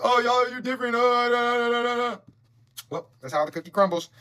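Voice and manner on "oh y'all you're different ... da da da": higher, whiny mock voice with nonsense babble; mock them as they whine and babble; mocking the toxic people. Mocking toxic people who play victim, higher and whiny. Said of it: "And now it's".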